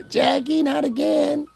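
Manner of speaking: high whining mock voice; mocking host whining at Jaguar; mock whine. Mocking the show host's line.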